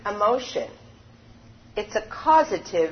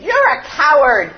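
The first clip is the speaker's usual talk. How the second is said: put-on loud angry shout from the boy; angry boy yelling at the echo; angry scream; 6 semitones higher, 8 dB louder than usual. A narrator's reading. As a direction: hurl it at the mountain. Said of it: "so he screamed".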